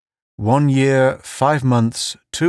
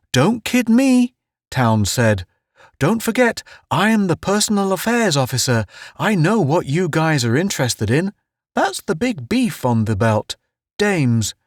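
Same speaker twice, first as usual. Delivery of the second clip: much higher, jovial needling; Town's ribbing voice; 7 semitones higher than usual. Acted. Town needles Pace about girls, high and jovial. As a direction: needle him, high and pleased with yourself.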